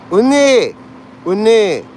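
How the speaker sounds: loud high call across the chat. Real, not acted. Streamer loudly hailing a viewer by name, a bright call. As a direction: shout the name to grab her attention.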